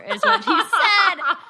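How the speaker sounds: high, loud, slow words through laughter; laughing tag line. Real, not acted. Telling how her husband snubbed her, laughing high and loud. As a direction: laugh out the punchline.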